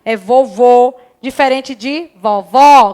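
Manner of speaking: vovô with a closed final ô, vovó with an open final ó. Shown as a demonstration. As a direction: set vovô's closed ô against vovó's open ó. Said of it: "ele é um som fechado".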